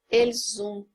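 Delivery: "elles ont" said as "el-zon", z linking the words; liaison z sound. Shown as a demonstration. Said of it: "vai ter o som de Z".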